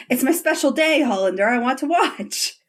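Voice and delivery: high, sing-song imitation of a demand; imitated character's line delivery. Host imitating Ilya telling Shane to perform, high playful demand. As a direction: play it up high, savouring the line. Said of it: "The way he says that".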